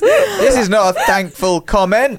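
high, loud, slowed protest through laughter; laughing objection. Real, not acted. Co-host objects to unthankful comment, laughing. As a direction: object loudly while laughing.

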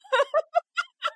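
high, squealing burst of laughter; laughing at the family feud over her funeral. Real, not acted. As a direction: let the laugh shoot up high.

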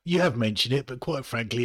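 usual speaking voice

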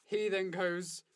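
ordinary talk

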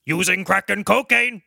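put-on declaiming bailiff voice; bailiff character. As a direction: read out the charge as a mock bailiff. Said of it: "the bailiff comes and says".

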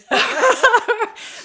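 loud high burst of laughter; real laughter, high and loud. Real, not acted. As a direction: crack up at the memory. Laughs at own story.

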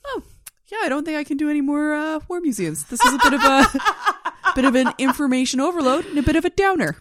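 lightly hammed worn-out husband; tired husband impression. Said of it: "he was like".

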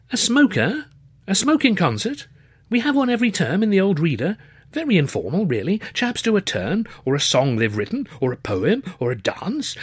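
high-pitched posh student, astonished; young Cambridge gentleman. A narrator's reading. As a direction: play the excitable undergraduate, incredulous. Narrator voices Luscombe.

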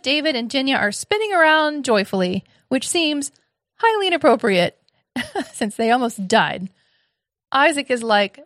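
usual speaking voice

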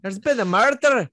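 loud high Glaswegian detective; Glaswegian accent. Pretend. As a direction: boom it out in Glaswegian. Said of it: "In a Glaswegian accent".

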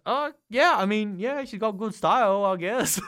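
high, loud, unsure going-along; confused agreement. Retold or imitated. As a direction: humour them, baffled but agreeing. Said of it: "So I'm like".

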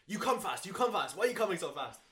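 high, loud hammed-up Italian accent yelling; mock Italian accent. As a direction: shout like an angry Italian biker. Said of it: "he has this, like, Italian accent".

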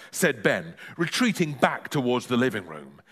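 ordinary delivery, nothing marked

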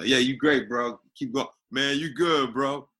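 imitating a buddy cheering him on at premieres; hyped-up approving bro. Approving American friend at a premiere, mock hype voice.

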